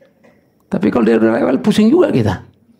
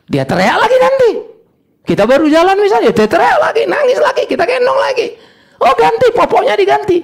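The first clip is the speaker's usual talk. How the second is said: very high, exasperated outcry; impassioned preaching; 4 dB louder than usual. Acted. Preacher on grandchild crying yet again, high and worked up. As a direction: pile up the complaints at a high pitch. Preaches baby's endless demands.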